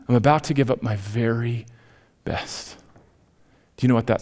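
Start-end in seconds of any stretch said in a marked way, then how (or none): 0.1-2.8 softer, trailing into a breathy last word; fading to a whisper. Retold or imitated. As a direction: let the repeat die away to nothing. Repeated thought, fading out.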